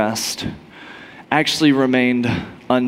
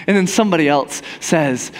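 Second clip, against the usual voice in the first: ordinary talk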